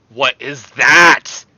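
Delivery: slow, loud demand, swelling on the last word; loud disbelief. Real, not acted. Baffled by what he just saw, loud disbelief. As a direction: blast it out slowly, baffled.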